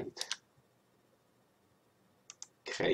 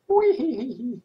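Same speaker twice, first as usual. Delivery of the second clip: high, playful wee; playful squeal; 14 semitones higher, 7 dB louder than usual. Real, not acted. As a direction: squeal it with glee. Wave effect the other way.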